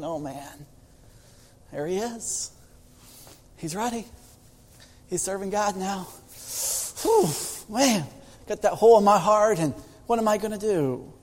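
soft, hushed, heavy-hearted murmur; quiet inner thought. Acted. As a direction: breathe it out like a private ache. Mother's sad inner thoughts.

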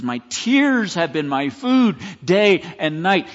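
drawn-out, loud scriptural lament; anguished lament. Acted. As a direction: lean hard on each phrase of grief. Quotes psalmist in anguish.

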